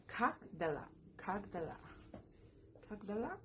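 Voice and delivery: "kak dala" as two crisp separate words; teacher sounding out "kak dala"; pronunciation demo. Shown as a demonstration. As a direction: model "kak dala", pausing between repeats. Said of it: "Y se pronuncia".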